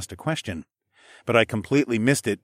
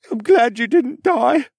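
put-on high, loud, slow tearful boy; sniffling boy; 7 dB louder, slower than usual. Boy sniffles into Alex's shoulder, high and tearful. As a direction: choke it out through tears. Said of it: "he sniffled against my shoulder".

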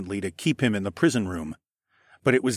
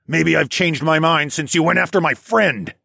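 raised, loud, menacing growl; angry growl; 6 semitones higher, 5 dB louder than usual. A narrator's reading. Dragon threatens the assassin who attacked a friend, growling. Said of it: "I growled".

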